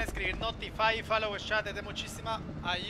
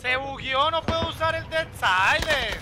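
frustrated complaint; 5 semitones higher, 6 dB louder, slower than usual. Real, not acted. Game glitch blocks his ability.

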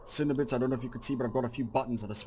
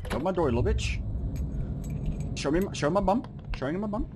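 taunting; 7 semitones higher, faster than usual. Real, not acted. Taunting the monster at door.

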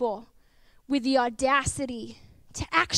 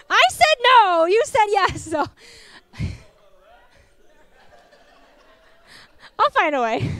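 playful divine retort; 6 semitones higher, 9 dB louder than usual. Acted. Voicing God, humorous retort.

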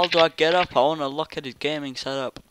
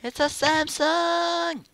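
amazed put-on voice; 12 semitones higher, slower than usual. Real, not acted.

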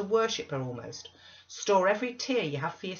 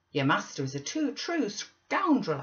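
raised, louder indignant retort; 6 semitones higher, 6 dB louder than usual. Acted. Missus Dean answers Zillah about her master, indignant. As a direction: denounce him, higher and louder. Narrator acts indignant reply.

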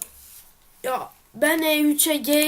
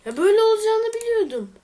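frustrated outburst; 7 semitones higher, 4 dB louder than usual. Real, not acted. Knew it would fail.

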